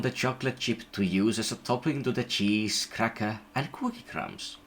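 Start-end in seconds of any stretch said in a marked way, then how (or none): none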